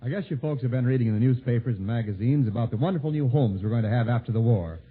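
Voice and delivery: ordinary talk, nothing marked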